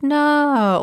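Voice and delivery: high, falsely reassuring no; unconvincing no. Retold or imitated. Producers humoring her so she keeps talking, unconvincing reassurance. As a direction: say it high and insincerely. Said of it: "in the most unconvincing no".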